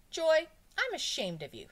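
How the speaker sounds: high, forceful telling-off; stern scolding. A narrator's reading. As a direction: scold a pupil out loud. Teacher scolds pupil in story.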